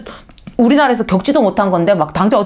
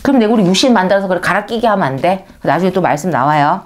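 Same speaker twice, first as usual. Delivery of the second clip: normal talking voice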